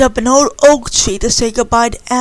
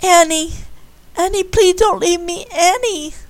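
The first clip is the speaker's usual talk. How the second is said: high, desperate plea; a girl begging her best friend to stay, pleading high; 7 semitones higher than usual. A narrator's reading. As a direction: beg her friend not to go. Candy begging Annie to stay.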